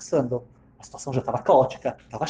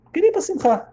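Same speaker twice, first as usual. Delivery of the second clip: much higher, emphatic repeat; indignant repeat. Retold or imitated. Wife recounting that her husband wanted the celebration, indignant. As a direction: repeat it in high-pitched disbelief.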